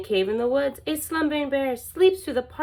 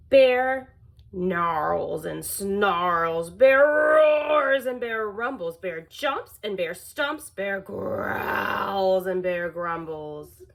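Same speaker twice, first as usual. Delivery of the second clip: slow, growly storytelling; slow rumbling narration; 4 semitones lower, slower than usual. A narrator's reading. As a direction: growl the words out. Bear rampage read slow.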